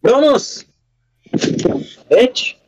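high sharp yell at the dog. Real, not acted. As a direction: bark orders at the dog. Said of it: "No need to yell".

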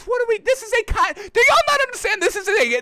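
fed up with the refs; exasperated. Real, not acted. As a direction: sputter in disbelief. Exasperated rant at viewers.

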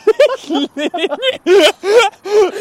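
big squealing laugh; loud high laugh. Real, not acted. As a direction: burst out laughing loudly.

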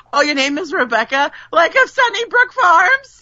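high, gushing mock amazement; mock delight. Person joking about Rebecca's name, mock delight. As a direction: imitate a person thrilled by their own joke.

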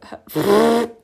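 loud burst of laughter; splutter, then burst out laughing. Real, not acted. Stumbles on phrase, cracks up.

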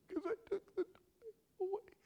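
put-on high, whimpering kid voice; tearful child. Child confessing to dad, tearful and high. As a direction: imitate a small child confessing through mock tears.

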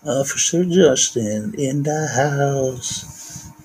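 slow, drawn-out hype announcement; announcer voice. Acted. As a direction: announce him like a club MC. Shouting out friends' names.

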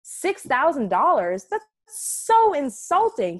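a hammed-up insulted boss; insulted Michael Scott. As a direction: play up the outrage at a lowball offer. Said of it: "He was insulted".

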